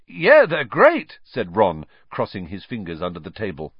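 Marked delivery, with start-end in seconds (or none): 0.1-1.2 loud, high, slow, overdone praise; forced enthusiasm. A narrator's reading. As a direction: oversell it unconvincingly. Ron lying, forced enthusiasm.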